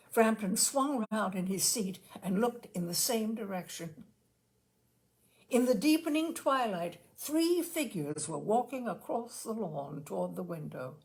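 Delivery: usual speaking voice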